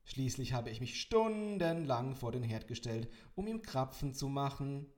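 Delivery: loud on purpose so he hears; extra loud. A narrator's reading. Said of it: "kam es sofort und extra laut".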